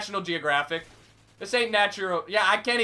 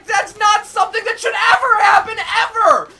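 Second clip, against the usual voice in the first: high indignant yell; outraged shout; 9 semitones higher, 8 dB louder than usual. Real, not acted. Gamer ranting at the game's unfairness, high loud shout. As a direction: hammer home the ever.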